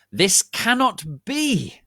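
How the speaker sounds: high, loud, slow shout; angry shout. Acted. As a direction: shout it slowly in outrage. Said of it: "she shouted angrily".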